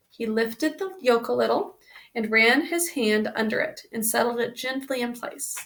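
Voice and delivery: ordinary delivery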